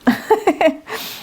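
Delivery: short high laugh; breathy laugh. Real, not acted. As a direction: let a quick laugh escape. Laugh after being out of breath.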